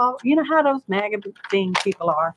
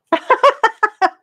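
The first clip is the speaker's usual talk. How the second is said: high burst of giggles; real high-pitched laughing fit; laughter; 12 semitones higher, 4 dB louder than usual. Real, not acted. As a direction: laugh at your own joke. Amused by her own remark.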